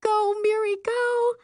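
high, urgent cheering cry; inner scream. A narrator's reading. Sister silently rooting for sister, inner scream. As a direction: squeal it out like urging on a runner. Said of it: "while my insides scream".

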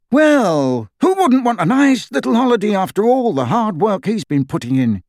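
high, bouncy comic retort; high, animated mock reasoning; lively radio joker. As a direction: play a cheeky radio guest, pitched high.

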